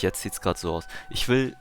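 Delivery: normal talking voice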